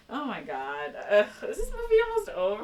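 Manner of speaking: high, fast exasperated whine. Real, not acted. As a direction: whine like you're bored stiff. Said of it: "complaining, fast and high".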